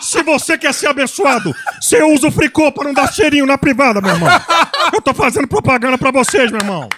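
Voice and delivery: loud preacher voice, fiery sermon; Silas Malafaia impression. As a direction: preach hard, shouting, meu irmão. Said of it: "você puxou o Silas Malafaia".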